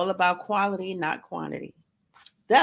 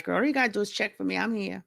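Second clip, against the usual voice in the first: ordinary talk, nothing marked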